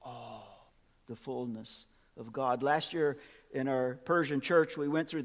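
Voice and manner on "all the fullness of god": much softer and slower, "God" drawn out; quiet reverent echo of the verse; hushed awe. Acted. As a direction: let it sink in, almost under the breath.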